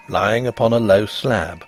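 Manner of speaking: ordinary delivery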